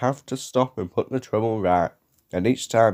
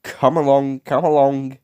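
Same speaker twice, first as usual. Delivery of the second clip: lightly voiced engine, higher and louder; puffing engine; 5 semitones higher, 5 dB louder than usual. A narrator's reading. James to the trucks, puffing encouragement. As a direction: urge them on with puffing effort. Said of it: "he puffed".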